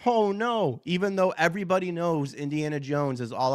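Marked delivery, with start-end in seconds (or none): none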